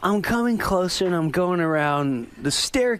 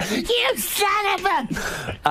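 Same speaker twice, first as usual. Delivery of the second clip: mock angry shout. Telling the story of his mother, mock angry shout. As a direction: blurt it out in mock fury.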